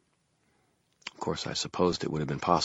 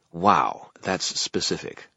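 dry and edgy; 2 semitones higher, slower than usual. A narrator's reading. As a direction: deliver it dryly, with an edge. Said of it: "with his voice dry and edgy".

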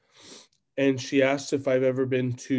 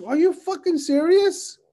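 loud, very high disbelief; incredulous; 6 dB louder than usual. Retold or imitated. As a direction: re-enact an outraged double take, pitched way up. Retelling own incredulous reply.

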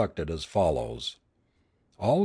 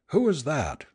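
higher-pitched, understated challenge; mild shouted question; 6 semitones higher than usual. A narrator's reading. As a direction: demand it on a raised pitch, without full volume. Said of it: "shouted Jagat".

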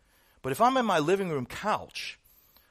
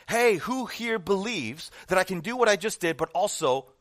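raised-voice challenge to a crowd; higher, projected showman's call; retold yell; 5 semitones higher, 4 dB louder than usual. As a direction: call out like a performer working the crowd. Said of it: "he yelled to the crowd".

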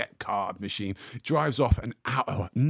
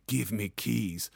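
slow menacing demand; 2 semitones lower, 6 dB quieter, slower than usual. Retold or imitated. Russian clamper demands keys.

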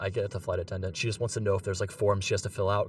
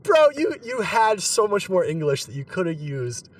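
much higher and louder, incredulous outburst; exasperated; 8 semitones higher, 4 dB louder than usual. Retold or imitated. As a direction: blurt it out in mock exasperation. Exasperated retold reply to the passenger.

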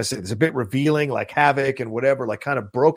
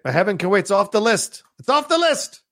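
high-pitched exclamation, climbing higher on the repeat; excited, rising proclamation; emphatic announcement; 9 semitones higher than usual. Real, not acted. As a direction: declare it struck out, voice rising sharply. Abruptly throws a film off list.

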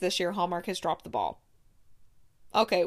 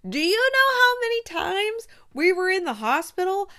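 rising disbelief, almost pleading; exasperated rant; 7 semitones higher, 5 dB louder than usual. Real, not acted. Reviewer ranting to viewers about no hot doctors, loud exasperated disbelief.

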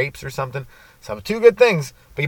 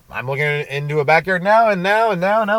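higher, louder, rhythmic taunt; mock defiance; 6 semitones higher, 5 dB louder than usual. Driver to a cop about backyards, mock defiant. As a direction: hammer each repeat at the cop. Retelling himself defying the cop.

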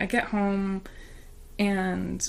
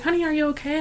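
higher, kind asking; concerned coworker; 9 semitones higher, faster than usual. Retold or imitated. Coworker at crosswalk asking if she's okay, caring. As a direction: check on her gently. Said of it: "she's like".

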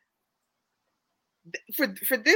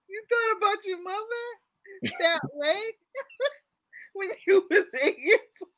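laughing through a quote; 8 semitones higher than usual. Real, not acted. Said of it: "You are literally over there weeping".

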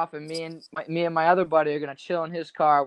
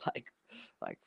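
laughing; 3 dB louder than usual. Real, not acted. Laughing at the cop's reply.

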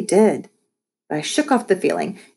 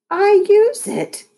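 high, drawn-out, louder retort; indignant protest; 5 dB louder, slower than usual. A narrator's reading. Mom defending her unused treadmill, indignant. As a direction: protest as if wrongly accused.

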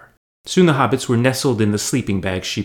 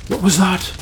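higher, alarmed hobbit voice; startled; 10 semitones higher, 3 dB louder than usual. A narrator's reading. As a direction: jump at a sudden noise. Sam startled by noise.

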